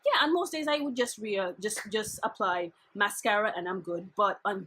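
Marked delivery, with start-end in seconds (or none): none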